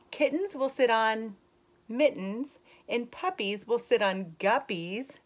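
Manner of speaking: put-on slow, lilting read-aloud voice for kids; story reading. Teacher reading a rhyming book to young kids, lilting. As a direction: read the rhyme brightly to the class. Reads picture book to children.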